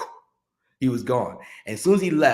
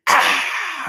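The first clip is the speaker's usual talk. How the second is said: high loud mock crowd scream; 5 dB louder than usual. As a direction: scream like a crowd when the lights drop. Crowd screams as lights drop.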